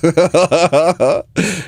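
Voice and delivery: a burst of high laughter; amused squeal of a laugh. Real, not acted. Laughs at own provocation.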